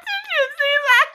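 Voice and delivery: high squeal of laughter; can barely get the words out for laughing. Real, not acted. Streamer asking viewers, laughing hard.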